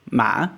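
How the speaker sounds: "ma" dipping, then rising smoothly; questioning tone. Shown as a demonstration. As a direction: ask "ma" like a question, unbroken. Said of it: "questioning tone in Southern Vietnamese".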